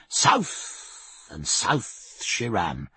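usual speaking voice

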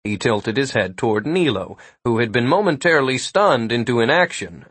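usual speaking voice

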